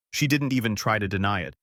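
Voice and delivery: ordinary delivery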